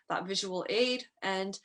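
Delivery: ordinary talk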